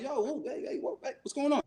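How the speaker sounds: high, soft-edged retold "whoa, hey"; alarmed intercepting. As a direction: act out heading someone off. Stopping them leaving, retold.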